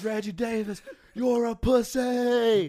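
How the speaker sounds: high, slow heckling yell at the player; mock stadium heckle, drawn out and high; retold heckling shout. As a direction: bellow the insult across the stadium. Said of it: "Levi says".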